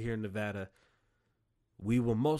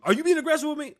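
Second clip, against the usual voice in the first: much higher, loud accusing shout; mock cop yelling; 11 dB louder, faster than usual. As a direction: yell it like a cop provoking you. Acted cop during traffic stop.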